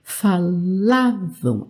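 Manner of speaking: "Falavam" very slowly, LA drawn out; stress on LA. Shown as a demonstration. Teacher marking the stronger syllable, stress on LA. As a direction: stretch the middle LA of "Falavam", low pitch. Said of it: "essa sílaba é mais forte".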